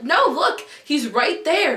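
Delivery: high, loud retold urgency; excited insistence. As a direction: insist urgently that the friend look. Retold insisting to friend, freaking out.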